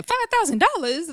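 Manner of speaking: high-pitched, rising mock disbelief; mock incredulous. Retelling D T B's reaction to the prize money, mock incredulous. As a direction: echo it high, as if it were nothing. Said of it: "D T B's gonna say".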